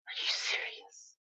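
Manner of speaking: real exasperated whisper under the breath; whispered disbelief. Real, not acted. Owner to pet over the flower bed, exasperated whisper.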